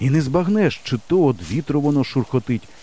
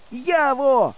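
high, drawn-out holler; full-voice call; 13 semitones higher than usual. A narrator's reading. Boy searches for his friend, full-voice call. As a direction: holler for someone far away. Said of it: "кричу я на повну губу".

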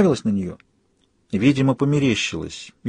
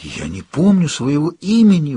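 higher-pitched horrified realisation; 5 semitones higher than usual. A narrator's reading. Woman finds she has forgotten her name, horrified. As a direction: say it in dread, pitch lifted. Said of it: "в ужасе проговорила она".

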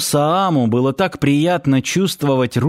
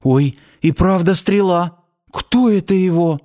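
frightened; 5 semitones higher than usual. Acted. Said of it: "Испуганно стала оглядываться".

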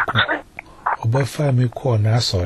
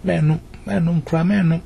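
higher-pitched quoted insistence; raised pitch, mock insistence; retold insistent reply; 6 semitones higher than usual. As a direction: repeat it high, as an acted reply. Acts out answer about taking medicine.

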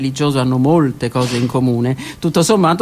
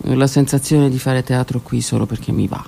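normal talking voice

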